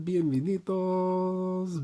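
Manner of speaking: long drawn-out bienvenidos; stretched announcer call. Acted. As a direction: hold bienvenidos like a ring MC. First stretched take.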